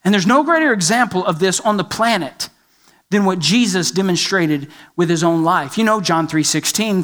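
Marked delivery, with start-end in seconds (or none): none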